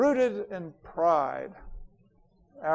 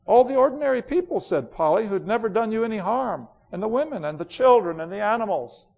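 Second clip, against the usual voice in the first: put-on higher, fast, indignant girl; 6 semitones higher, 4 dB louder, faster than usual. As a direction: protest in a rush for the innocents. [name] protests indignantly.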